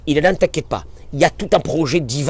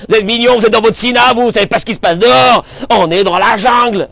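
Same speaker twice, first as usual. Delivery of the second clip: louder, higher voice of a man telling the rabbi off; defiant congregant; 5 semitones higher, 5 dB louder than usual. Retold or imitated. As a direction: play the street-wise doubter lecturing the rabbi.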